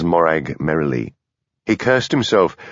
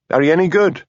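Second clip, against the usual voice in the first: blunt; 9 semitones higher, faster than usual. A narrator's reading. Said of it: "asked Hamish bluntly".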